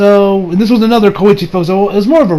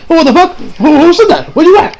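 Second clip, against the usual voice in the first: high, louder startled search; startled confusion; 10 semitones higher, 3 dB louder than usual. Acted. Koichi looks around for whoever called him, startled. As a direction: act out a bewildered lookout, pitched way up.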